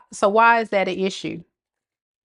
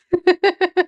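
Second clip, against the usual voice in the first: laugh; 11 semitones higher, 3 dB louder than usual. Real, not acted. Laughs at own impression.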